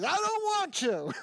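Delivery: put-on high, sulky childish whine; whiny refusal. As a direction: sulk like a kid.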